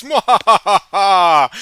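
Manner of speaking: slow, high, loud villain laugh; evil cackle. Retold or imitated. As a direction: cackle like a mad scientist. Villainous show taunting viewer.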